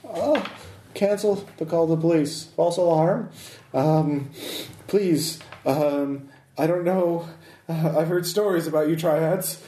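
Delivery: high, stammering voice calling off the police; scared man groveling before triads; nervous pleading businessman. Acted. NPC begs after failed bluff.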